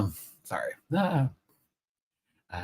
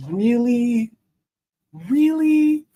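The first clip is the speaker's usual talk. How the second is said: tearful disbelief; 12 semitones higher, 6 dB louder, much slower than usual. Real, not acted. Moved to tears by fans' support.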